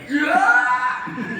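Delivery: high, loud burst of power, laughing; triumphant yell. Retold or imitated. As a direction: roar like a sudden superhero. Skinny guy suddenly gets strong.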